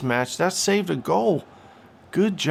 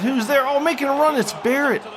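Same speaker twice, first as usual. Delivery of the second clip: loud, high rising commentary; excited call; 9 semitones higher, 3 dB louder than usual. Real, not acted. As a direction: get caught up in the chance. Live chance call.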